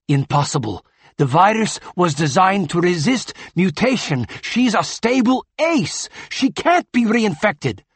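agitated denial. Acted. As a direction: deny it with rising alarm. Tachyon denies the diagnosis.

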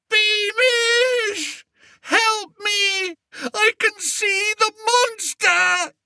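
very high, crying shriek for help; terror-struck shriek. A narrator's reading. Lost king shrieking for Beamish, terrified and high. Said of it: "terror-struck shriek".

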